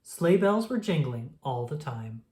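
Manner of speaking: usual speaking voice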